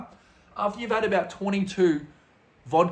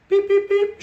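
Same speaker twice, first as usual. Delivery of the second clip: high, loud electronic beeps; three squeaky little beep noises; imitated beeps; 7 dB louder than usual. Storyteller mimics showing the driver an address, high beeping.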